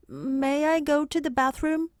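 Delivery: a hint of a schoolboy asking permission; child voice. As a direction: ask like an eager little boy. Voices Arthur the boy.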